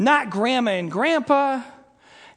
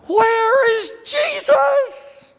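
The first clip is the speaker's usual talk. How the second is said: high, loud, long-drawn wail; desperate wail; 12 semitones higher, 6 dB louder, much slower than usual. Acted. Mary stuck on finding Jesus, loud acted wailing. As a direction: wail it slowly, like an inconsolable child. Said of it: "That's where Mary was".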